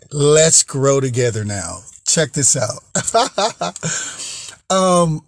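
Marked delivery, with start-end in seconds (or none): 4.7-5.3 high giggling laugh. Real, not acted. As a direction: giggle up high, breathy and unable to stop. Host cracking up at own joke.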